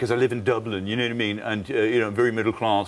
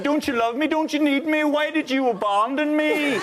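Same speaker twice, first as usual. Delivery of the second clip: put-on much higher, pleading Northern Irish accent with a laugh; needy Northern Irish accent; 13 semitones higher than usual. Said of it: "it's very needy".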